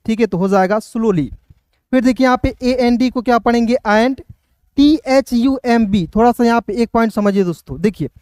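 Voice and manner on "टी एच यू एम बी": usual speaking voice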